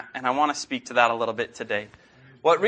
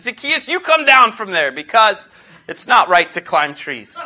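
higher, louder mock scolding; mock scolding Jesus; 5 semitones higher, 5 dB louder than usual. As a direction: wag a playful finger at Zacchaeus, joking. Preacher repeats child's joke version.

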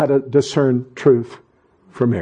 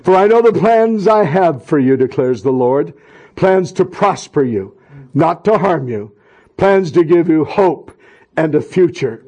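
benediction; 3 semitones higher than usual. Acted. Blessing pronounced.

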